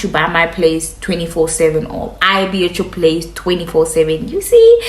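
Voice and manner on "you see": high, loud see; emphatic question. Real, not acted. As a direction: toss it at the audience. Emphatic rhetorical question to viewers.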